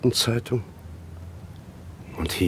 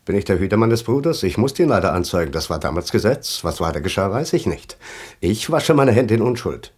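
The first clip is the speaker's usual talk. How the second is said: louder, fast defensive voice of a neighbour dodging guilt; mock self-justification; 4 dB louder than usual. Survivor mimics the neighbour denying guilt, defensive and loud. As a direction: rattle off excuses, washing your hands.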